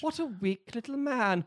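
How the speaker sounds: high, slow mocking sneer; sassy villain voice. Voicing Maleficent's sass.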